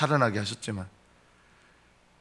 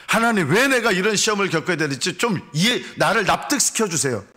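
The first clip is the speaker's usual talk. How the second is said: higher, louder insistent plea; presumptuous demand; 6 semitones higher, 3 dB louder than usual. Retold or imitated. Preacher mimicking a believer demanding reasons from God, insistent. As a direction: demand that God justify himself. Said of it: "이것도 방자한 거예요".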